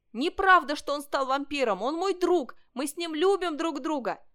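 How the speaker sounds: high, loud, heartfelt cry; passionate shouted protest. A narrator's reading. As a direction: shout in defence of a friend. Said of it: "Ванчо кричал". (горячий крик в защиту друга)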